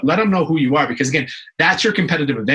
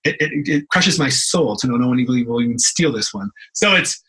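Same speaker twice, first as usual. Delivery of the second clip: ordinary talk, nothing marked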